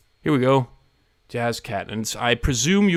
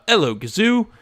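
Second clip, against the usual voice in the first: higher-pitched mock Cockney greeting; Cockney accent; 9 semitones higher, 4 dB louder than usual. Streamer reading LP Chip's request, Cockney accent. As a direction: greet them in a put-on Cockney. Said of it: "you've got a Cockney accent".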